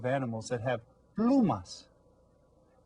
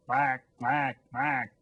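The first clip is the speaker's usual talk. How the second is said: nasal quacking like a duck; playful duck call; much slower than usual. Shown as a demonstration. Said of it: "This animal says".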